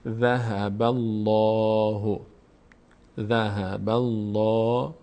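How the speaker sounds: slow "ذهب الله" with dark rounded "الله"; full, heavy Allah. Shown as a demonstration. Teacher models the full-mouthed name, heavy "l". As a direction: recite "ذهب الله" slowly, mouth opened upward on a heavy long "lo".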